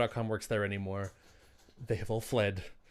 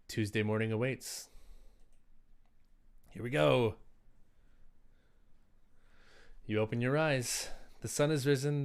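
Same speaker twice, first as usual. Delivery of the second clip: story narration. Acted. Game master scene narration.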